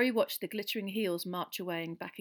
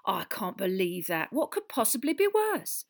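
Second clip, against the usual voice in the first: incredulous; 3 semitones higher, 7 dB louder than usual. A narrator's reading. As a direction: react as a shocked landlady. Barmaid shocked by the gossip.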